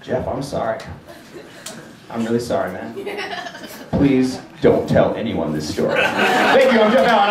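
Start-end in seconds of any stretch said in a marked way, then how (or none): 0.0-6.0 low, soft, halting apology; acted hushed, mortified plea; sheepish realization. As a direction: apologize in dawning shame, with long pauses. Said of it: "having this moment of realization".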